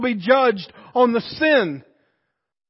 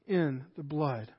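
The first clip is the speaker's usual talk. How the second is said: hushed close; 9 semitones lower, 14 dB quieter than usual. Real, not acted. Preacher closing on the blood, hushed. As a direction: let it fall to near silence.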